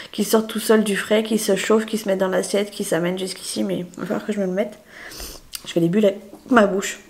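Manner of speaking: ordinary delivery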